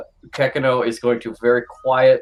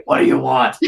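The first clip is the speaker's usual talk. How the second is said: voiced demand; 5 dB louder, faster than usual. Retake of Canton's question.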